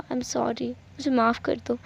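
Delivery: soft, slow, sincere apology; tender apology. Acted. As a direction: say sorry quietly, as if mid-hug. Hugs brother tightly and apologises. (धीमी, नरम आवाज़ में माफ़ी)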